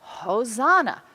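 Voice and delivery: loud, drawn-out mock crowd cheer; mild imitation of a shouting crowd; imitated shout. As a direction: call it out slowly and loudly, like a retold cheer. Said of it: "They were shouting".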